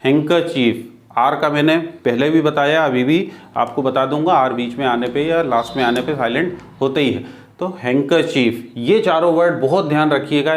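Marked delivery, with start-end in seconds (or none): 0.0-0.9 हैंकरचीफ with no d before the k; silent D. Shown as a demonstration. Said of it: "ये यहाँ silent होगा". 7.8-8.7 हैंकरचीफ with no d before the k; silent D. Shown as a demonstration. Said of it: "ये यहाँ silent होगा".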